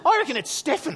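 lightly voiced eager boy; young son. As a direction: imitate a boy giving his verdict. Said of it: "He goes".

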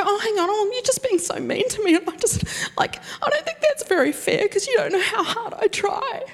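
high, whimpering, half-laughing mock whine; mock whiny self-pity. Preacher mocking how he whines at the enemy's insults, high and whimpering. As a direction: pout and whine like a wounded child, laughing at yourself. Preacher mocks his own whining.

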